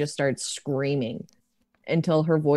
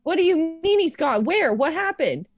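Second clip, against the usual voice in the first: much higher, fast, panicked; shrill-free frightened questions; frantic shock; 12 semitones higher than usual. Acted. Elliot hears Oliver is gone, frantic.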